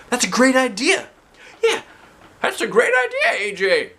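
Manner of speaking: high bright praising voice; teacher praise. Acted. Doctor Nicholas praising A J's plan, delighted. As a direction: cheer the idea on.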